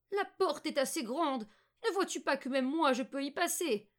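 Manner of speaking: loud, high, scolding witch voice; exasperated old witch. Acted. Witch showing Margot the oven door, loud exasperated scolding. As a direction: scold the girl as an impatient witch.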